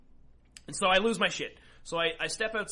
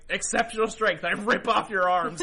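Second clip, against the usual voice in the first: raised, louder gleeful announcement through a laugh; gleeful declaration; 4 semitones higher, 7 dB louder than usual. Retold or imitated. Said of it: "I'm like".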